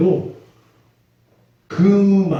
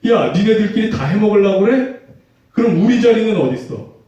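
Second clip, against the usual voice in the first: higher and louder, a jealous demand; indignant disciple shouting; 7 semitones higher, 4 dB louder than usual. Retold or imitated. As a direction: play the left-out disciple, fuming. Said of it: "화를 내는 거예요".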